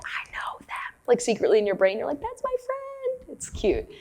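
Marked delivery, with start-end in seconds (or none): 0.0-0.9 soft unvoiced whisper; whispered thought. Retreat-goer secretly thinking about new friends, whispering. 2.2-3.4 high, slow, soft squeak of delight; cute high voice. Retold or imitated. Retreat-goer silently claiming a friend, high cooing voice.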